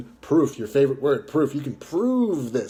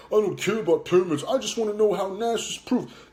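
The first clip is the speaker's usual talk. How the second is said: higher, childish mock of Fig; lightly voiced bratty child; mocking whiny kid; 6 semitones higher than usual. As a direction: parody him like a whining six-year-old. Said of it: "You sound like a six-year-old".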